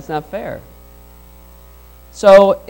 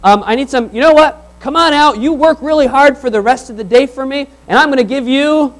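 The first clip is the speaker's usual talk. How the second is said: landowner calling out, higher and louder; eager landowner calling out; 6 semitones higher, 5 dB louder than usual. Retold or imitated. Landowner hiring workers at noon, eager higher call. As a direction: call the late workers in with gusto. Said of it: "he says".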